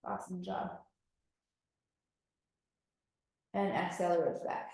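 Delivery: soft breathing cues, almost whispered; quiet guided breathing. Acted. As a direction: ease them into calm.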